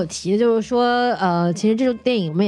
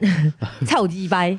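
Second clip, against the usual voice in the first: Taiwanese Hokkien cursing, barked out; taiwanese accent; 2 semitones lower, much slower than usual. Pretend. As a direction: swear like a Taiwanese guy in Hokkien. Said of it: "那个讲台湾腔的大老师又出来了". (台湾腔闽南语骂人)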